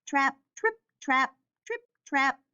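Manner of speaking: ordinary delivery, nothing marked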